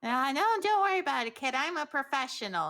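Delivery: breezy, dismissive adventurer; cocky adventurer voice. Acted. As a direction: brush it off with swagger. Jasper voiced brushing off warning.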